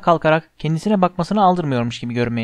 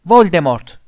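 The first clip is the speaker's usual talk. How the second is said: loud defiant shout; 7 dB louder, slower than usual. A narrator's reading. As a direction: bellow the name out in defiance. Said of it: "diye bağırdı Harry".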